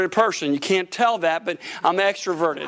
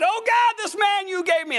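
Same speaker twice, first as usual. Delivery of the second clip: high, wailing complaint to God; mock wife's lament; 11 semitones higher than usual. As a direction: ham up the wife crying out to God. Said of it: "and she said".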